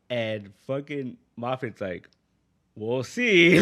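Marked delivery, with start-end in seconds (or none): 2.8-3.6 loud, high teasing "we'll see"; mock-smug host reply; smug tease. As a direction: tease it like a showman holding back a secret. Moffat's teasing reply retold.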